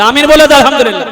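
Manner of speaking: fast loud cry of thanks; loud praise call. Real, not acted. (ज़ोर से तेज़ पुकार)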